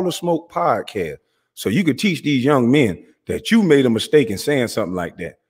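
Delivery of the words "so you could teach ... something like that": ordinary delivery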